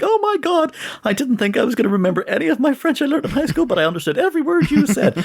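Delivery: very high, gushing squeal; excited Rachel. Retold or imitated. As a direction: squeal it like a thrilled Friends character. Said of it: "and she's like".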